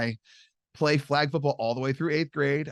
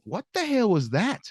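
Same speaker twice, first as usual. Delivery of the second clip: high, astonished exclamation; jaw-dropped disbelief; amazed; 9 semitones higher than usual. Retold or imitated. Teen seeing a friend's punt snap, astonished.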